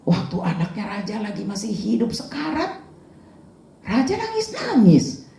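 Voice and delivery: high, bewildered exclamation; a servant voicing confusion to his king; puzzled bodyguard. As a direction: sound baffled by the king's behaviour. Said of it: "Pengawalnya sampai bingung".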